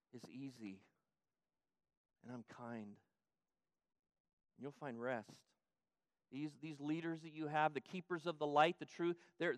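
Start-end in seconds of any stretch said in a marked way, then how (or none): 0.1-5.3 soft, very slow and tender; quiet reassuring whisper-soft promise; gentle invitation. Acted. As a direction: hush down to comfort the weary. Jesus' gentle invitation, contrasted.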